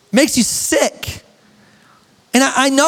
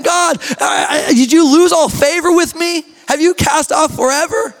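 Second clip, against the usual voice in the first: crying out to God; 5 semitones higher than usual. Acted. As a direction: cry it out to heaven in anguish. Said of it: "David's crying out".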